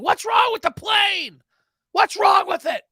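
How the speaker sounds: high, loud, slow mock scream; mock screaming passenger. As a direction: yell it like an irate passenger stuck at the gate. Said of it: "They were screaming".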